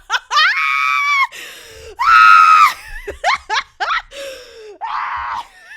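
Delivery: high, loud cackling howl of laughter; laughing hard at her own rant, loud and high; loud laughing shriek. Real, not acted. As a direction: burst out laughing at yourself. Breaks into laughter.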